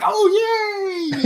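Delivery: gleeful cheer. Retold or imitated. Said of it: "I was like".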